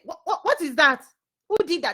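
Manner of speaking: high, stammered challenge; acted questioning. Acting out the observer's questioning.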